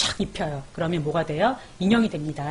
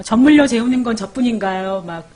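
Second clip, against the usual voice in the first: ordinary talk, nothing marked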